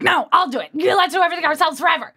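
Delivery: high, fast panicky rush, half joking; mock freak-out. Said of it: "I at first freaked out and was like".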